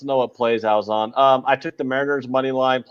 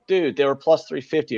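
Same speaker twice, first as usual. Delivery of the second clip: normal talking voice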